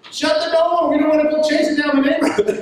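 high-pitched comic panic; retold urgent cry; urgent mock alarm. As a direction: blurt the order up high, playing it for laughs. Retold urgent order, played comically.

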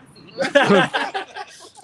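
loud high laugh breaks out; big laugh; high burst of laughter. Real, not acted. Laughs at wife joke.

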